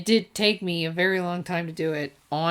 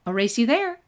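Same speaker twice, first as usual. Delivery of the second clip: louder, higher eager dare; playful challenge; 6 semitones higher, 5 dB louder than usual. Acted. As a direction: challenge him to a race with glee. Sakura challenges, dashes off.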